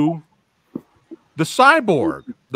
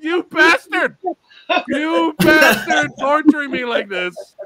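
raised, drawn-out playful outrage; mock cursing; 5 semitones higher, slower than usual. Cursing the director while watching, mock outrage. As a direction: curse the director in mock agony. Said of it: "I was cursing you, Ranjit".

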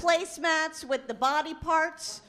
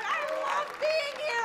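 excited gush; 11 semitones higher than usual. Real, not acted. Gushing opener.

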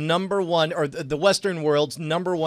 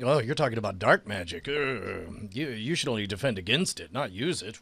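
lower, soft mock sage warning; mock wise mentor; 9 semitones lower, 6 dB quieter than usual. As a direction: intone it like a fantasy mentor.

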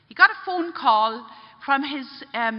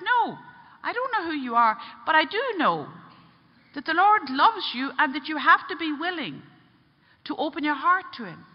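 high, emphatic reassurance; retold impassioned reply; insistent; 5 semitones higher than usual. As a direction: press the point home earnestly. Priest firmly answers the angry man.

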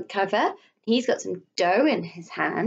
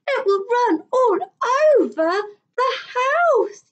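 put-on scared child; 5 dB louder than usual.

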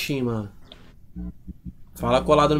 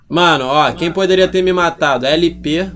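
loud accusing; 3 semitones higher, 9 dB louder than usual. Real, not acted. Accusing LP loudly.

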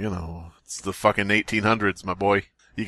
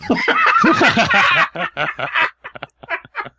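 squealing burst of laughter; high shrieking laugh; 3 dB louder than usual. Real, not acted. Cracking up mid-reading at his own line, high squeal.

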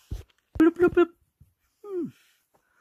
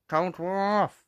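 ordinary delivery

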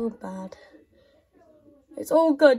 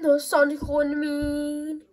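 ordinary talk, nothing marked

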